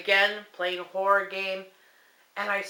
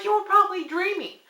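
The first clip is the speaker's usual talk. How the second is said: mock husband; 9 semitones higher than usual. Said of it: "He's like".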